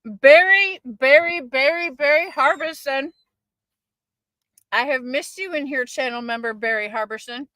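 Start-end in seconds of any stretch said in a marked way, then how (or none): 0.0-3.1 loud, rising sing-song call of a name. Real, not acted. Calling out a returning chatter.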